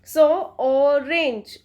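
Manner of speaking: Orange drawn out slowly so the O is heard; slow model word. Shown as a demonstration. Teacher modelling the vowel for students, slow. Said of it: "ओ की sound आ रही है यहाँ पर".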